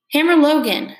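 high, loud cry of recognition; surprised call. Acted. Churchgoer spotting Uncle Hammer, calling out surprised. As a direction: call his name out across the crowd.

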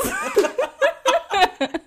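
loud high cackle; high laugh. Real, not acted. Laugh after joke.

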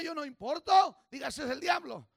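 loud, rising sermon voice; fiery preaching. Acted. Pastor urging the congregation, fervent preaching. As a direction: drive the point home to the church.

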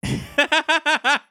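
high, hearty Welsh laugh; jolly laugh. Acted. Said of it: "fat, jovial Welshman".

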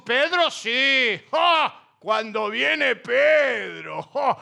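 slow, exaggerated, scoffing praise; mock the crowd gushing over Pedro; mocking admiration. Critics praising Pedro to belittle Pablo, slow mock awe. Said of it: "porque le dijeron".

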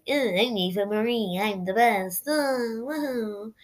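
drawn-out, wobbling mocking voice; hammed-up mimicry of a boastful diva, stretched out; Eva Marie mockery. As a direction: make fun of her in a silly put-on voice. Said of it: "making face, and she's like".

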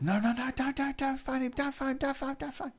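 very high squeaky begging; mock frantic pleading. Roommate begging him not to phone, high mock frantic pleading. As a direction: imitate her panicked, repetitive pleas in falsetto. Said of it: "started pleading again".

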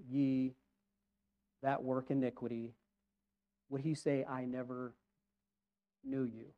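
low, soft, reflective pulpit voice; grave hushed pondering, voice dropped low; hushed preaching. Real, not acted. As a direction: muse aloud quietly, with long solemn pauses. Hushed musing aloud in sermon.

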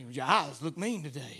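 usual speaking voice

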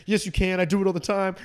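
high, loud mock retort; retold yelling back. Kid answering back across class, retold yelling. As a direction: argue back loudly as an imitated schoolkid. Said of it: "start fucking yelling at each other".

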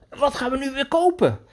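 put-on high, gleeful play question to the boy; playful excited question. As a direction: ask like the fun is just starting. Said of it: "toen had ik weer van".

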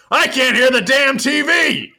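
high, loud cranky holler; Rick Flair. Retold or imitated. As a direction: shout it as a hammed-up wrestler impression. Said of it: "And Rick goes".

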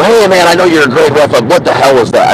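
butter up the ref then snap; imagined coach working the referee, mock plea; coach pleading with ref. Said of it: "You know".

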